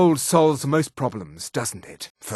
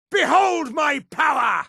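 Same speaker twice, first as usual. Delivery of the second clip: high, shouted show of power; battle cry; 9 semitones higher than usual. Acted. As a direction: proclaim your might at a high pitch. Battle taunt of elf mage.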